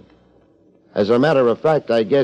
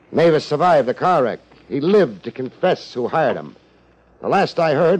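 ordinary delivery

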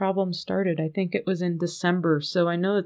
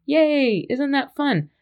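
high, loud little cheer; a happy yay for the listeners; excited cheer; 6 semitones higher, 4 dB louder, slower than usual. Real, not acted. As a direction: celebrate the free shipping. Cheering about free shipping.

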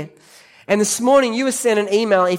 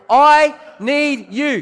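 slow, loud, higher declaration; slow emphatic; 7 semitones higher, 4 dB louder, much slower than usual. Shown as a demonstration. Preacher modelling the line for the congregation, slow and loud. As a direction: drag out each word and call it out. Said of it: "Turn to the person next to you and say".

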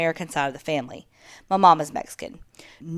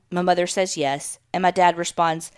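ordinary delivery, nothing marked

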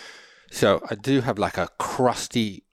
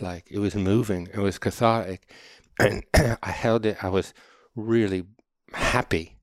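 ordinary delivery

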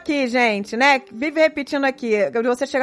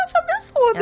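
pitched way up in outrage, a bit unlike her usual voice; indignant outburst; faster than usual. Real, not acted. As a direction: exclaim it, shocked at the injustice. Indignant at shouting husband story. (indignada, bem mais aguda)

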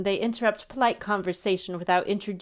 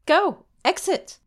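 sharp, loud order to leave, pitched high; commanding shout; 9 semitones higher, 3 dB louder than usual. Acted. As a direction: throw him out with a stagey command. Dismissing him theatrically.

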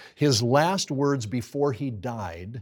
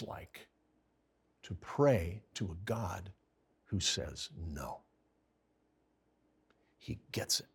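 hushed and tender; 6 semitones lower, 11 dB quieter than usual. Real, not acted. Tender sermon close.

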